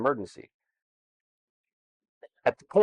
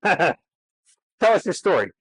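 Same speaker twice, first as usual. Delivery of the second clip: laughing invite; 6 semitones higher, 4 dB louder, much slower than usual. Real, not acted. Host handing over, laughing.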